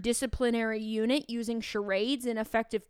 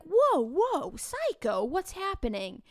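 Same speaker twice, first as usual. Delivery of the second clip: high startled whoa, then a baffled question; alarmed; 7 semitones higher, slower than usual. Retold or imitated. Teacher startled by the students' guess, mock alarmed. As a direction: pull up short in mock alarm.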